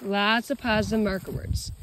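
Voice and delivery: normal talking voice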